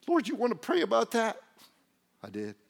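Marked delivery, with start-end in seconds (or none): 0.1-1.4 high, loud, doubting voice; mock-worried, raised-pitch query; incredulous question. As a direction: question it in squeaky disbelief. Doubter questions God's choice.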